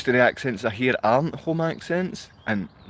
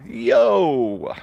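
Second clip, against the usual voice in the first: drawn-out call; 4 dB louder than usual. Real, not acted. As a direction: call out a stretched greeting. Sudden long call to viewers.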